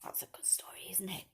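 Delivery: whisper. Real, not acted. Whispered ending.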